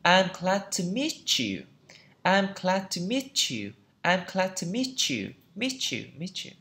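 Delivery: I'm glad to meet you, ending in "mee-chew"; linked "ch" sound. Shown as a demonstration. Teacher rereading for learners, meet you linked with "ch". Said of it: "Nó sẽ trở thành âm ch".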